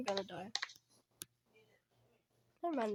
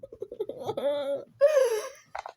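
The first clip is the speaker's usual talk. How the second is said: high wail breaking into laugh-like sobs; panicked wail; 7 semitones higher, 6 dB louder than usual. Real, not acted. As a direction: cry out in helpless fright. Caught by Granny in game.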